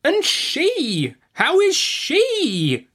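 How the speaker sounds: very high, drawn-out insistent demand; piercingly impatient aunt; sharp. A narrator's reading. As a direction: demand it sharply, stretching 'she'. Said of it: "said my aunt sharply".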